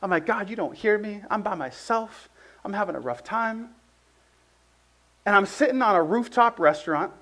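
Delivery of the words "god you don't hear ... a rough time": soft, subdued complaint to God; downcast prayer. Retold or imitated. Recalling his lonely prayer to God in Puerto Rico, soft and downcast. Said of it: "I was feeling like David".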